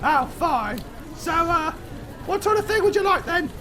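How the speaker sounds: exasperated barmaid. Acted. Barmaid lists the dishes, high exasperated voice. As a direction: build to loud exasperation at the customer.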